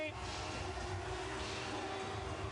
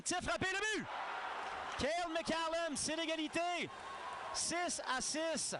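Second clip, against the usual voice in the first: excited goal call; 4 semitones higher than usual. Real, not acted. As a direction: announce the tie with excitement.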